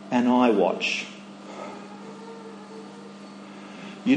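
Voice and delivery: a hint of sneering inner voice; understated accusing whisper-like tone; lightly voiced inner critic. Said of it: "little voices that are saying".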